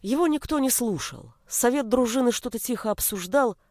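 ordinary delivery

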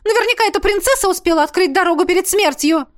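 high, stammering protest; distraught high-pitched objection; hysterical wail. A narrator's reading. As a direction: wail frantically in denial. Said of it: "вновь взвыла блондинка".

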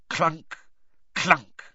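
ordinary delivery

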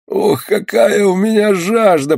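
high, slow, suffering wail; long-drawn pained groan; moaning. A narrator's reading. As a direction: moan the words with thirst. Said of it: "простонал он опять".

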